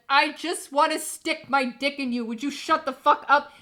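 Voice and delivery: loud hammed-up horny teen yelling; mock angry boyfriend. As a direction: shout it like an impatient teenage boy. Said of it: "And he's like".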